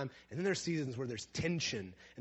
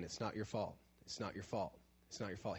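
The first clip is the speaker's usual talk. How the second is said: quiet repeated comfort; 3 semitones lower, 7 dB quieter than usual. Retold or imitated.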